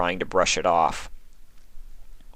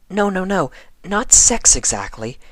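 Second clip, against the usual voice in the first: hurried reassurance; 12 semitones higher, 3 dB louder than usual. A narrator's reading. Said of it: "she hurriedly reassured".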